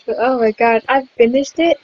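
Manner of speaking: loud, amazed announcement; excited. Real, not acted. Player telling viewers the game is finished, excited. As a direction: exclaim it with proud disbelief.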